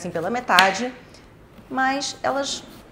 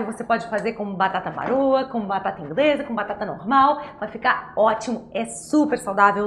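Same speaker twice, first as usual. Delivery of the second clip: usual speaking voice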